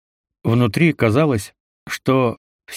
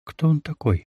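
softer panicked question; hushed and frightened; scared whisper; 4 semitones higher, 4 dB quieter than usual. A narrator's reading. Panicking while hiding.

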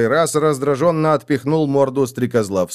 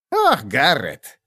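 slow, dismayed sigh of a name; огорчённо; much slower than usual. A narrator's reading. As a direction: chide a friend with drawn-out dismay. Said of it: "огорчённо цокнул языком гоблин". (огорчённый протяжный упрёк)

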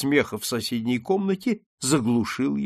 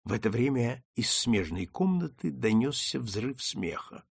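ordinary delivery, nothing marked

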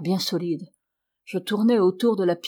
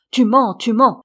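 loud, raised, insistent outcry; indignant accusation; 4 semitones higher, 9 dB louder than usual. A narrator's reading. Tearful Marion cuts off Vincent's tale, indignant outcry. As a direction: hurl the accusation twice, loud and high. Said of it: "répéta par deux fois bonne personne Marion".

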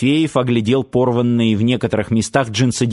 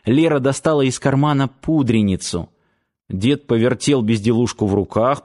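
usual speaking voice

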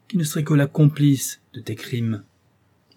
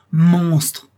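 higher, louder outburst; cried out; 5 semitones higher, 7 dB louder than usual. A narrator's reading. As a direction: hurl "Monstre!" at him. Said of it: "en m'écriant". (cri furieux de Victor : « Monstre ! »)

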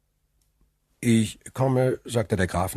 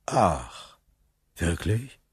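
feigned astonishment; 4 semitones lower, 3 dB quieter, much slower than usual. Acted. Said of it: "mit gespieltem Erstaunen".

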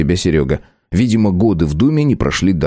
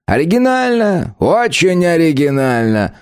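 mocking, higher, drawn-out praise; sarcastic; 9 semitones higher, slower than usual. A narrator's reading. Philipp Petrovich mocks the suggested nickname, sarcastic. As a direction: sneer at the idea, slowly. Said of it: "съязвил Филипп Петрович".

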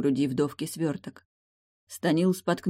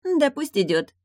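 high, loud, careless reply; carefree mermaid; 11 semitones higher, 5 dB louder than usual. A narrator's reading. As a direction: toss it off in a high voice. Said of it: "беспечно отозвалась Веселина".

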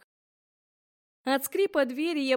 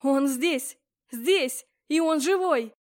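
joyful exclaim; 4 dB louder, slower than usual. A narrator's reading. Joyful shouting.

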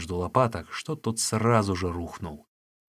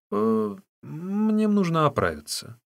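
slow, high, hesitant excuse; halting, put-on naive request; feigned simpleton; 10 semitones higher, slower than usual. A narrator's reading. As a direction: draw it out innocently.

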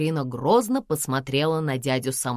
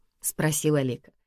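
plain reading voice

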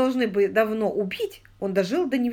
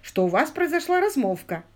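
insisting, pitched higher; raised voice; 7 semitones higher, 3 dB louder than usual. A narrator's reading. Lord insisting to his son's wife's friend, raising his voice. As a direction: raise the pitch to press the point. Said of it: "повышая голос".

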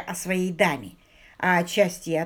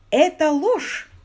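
loud, high, drawn-out protest; indignant denial; 9 semitones higher, 7 dB louder, slower than usual. A narrator's reading. Accused suitor to his jealous sweetheart, indignant denial. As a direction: throw the accusation back, slow and loud. Accused lover denies the affair.

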